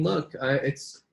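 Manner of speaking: ordinary talk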